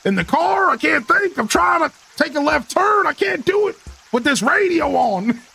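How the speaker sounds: mock frustrated driver. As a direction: whine like a flustered driver who can't turn. Mock-ranting at chat in a bit.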